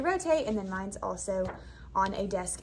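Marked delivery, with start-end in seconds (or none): 0.0-0.5 calling out to the class; bright classroom command; teacher call. Retold or imitated.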